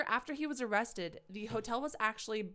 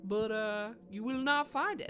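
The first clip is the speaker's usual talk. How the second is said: put-on H H Holmes's accent; 3 semitones higher than usual. Killer fobbing off the furniture man in a put-on accent. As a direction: play dumb in a put-on accent. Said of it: "I've given H H Holmes his accent".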